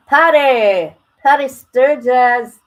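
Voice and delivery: loud, high call across the room; hollering a viewer's name. Real, not acted. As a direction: shout her name to get her attention. Calling out to a viewer.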